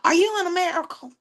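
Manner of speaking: a hammed-up skeptical caller on the phone; high, quick impression of a doubting caller; high fast caller voice. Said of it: "they be like".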